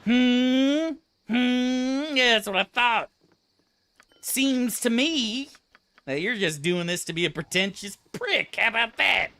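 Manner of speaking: high, loud mocking hum and jeer; mocking high-pitched taunt; smug taunting. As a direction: taunt them like a smug bully. Taunting an imagined pretentious person.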